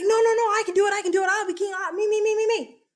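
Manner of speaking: put-on whiny kid begging to be picked; eager little brother. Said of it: "he's like".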